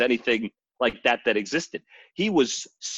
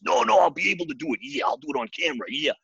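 eager Macho Man; 6 semitones higher, faster than usual. Retold or imitated. Macho Man insists he'll do it.

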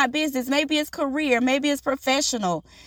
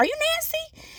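lilting, much higher and softer; high, softer question; 7 dB quieter than usual. Retold or imitated. Host asking the newcomer her name, high and soft. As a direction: ask gently in a raised voice. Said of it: "So I was like".

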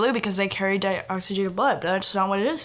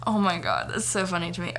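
plain reading voice

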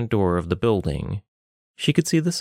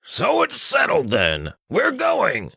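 high nasal-free gruff friend; 10 semitones higher, slower than usual. Acted. Richter calls to Saladia from behind, high put-on voice. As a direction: call out from behind her as Richter.

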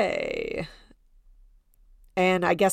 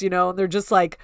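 normal talking voice

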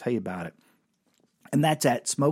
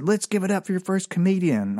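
emcee announcing; 7 semitones higher than usual. Retold or imitated. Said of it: "I said".

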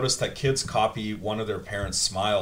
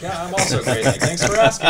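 high, louder mock-cheerful reply; fake cheery American; 7 semitones higher, 4 dB louder than usual. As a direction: overdo the friendly small talk. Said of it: "And then they're like".

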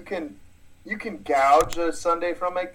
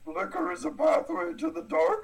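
high sage voice through a laugh; laughing mock Yoda; Yoda impression; 11 semitones higher than usual. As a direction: pronounce it like a tiny Jedi master. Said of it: "fun to talk like Yoda".

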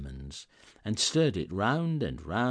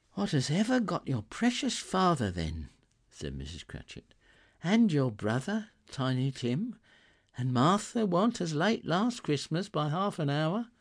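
lightly voiced fussing mother, higher; a hint of a busy, fretting mother; Mrs Cratchit voice; 8 semitones higher than usual. A narrator's reading.

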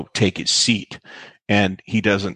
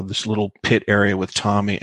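usual speaking voice

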